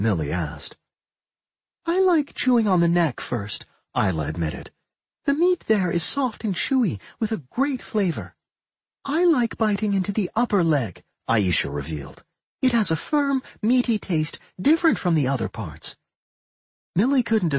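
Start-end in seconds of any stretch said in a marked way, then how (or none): 1.9-3.6 put-on high-pitched girl monster introducing herself; high, earnest creature-girl voice; warg twin girl. 5.2-11.0 put-on high-pitched girl monster introducing herself; high, earnest creature-girl voice; warg twin girl. 12.6-16.0 put-on high-pitched girl monster introducing herself; high, earnest creature-girl voice; warg twin girl.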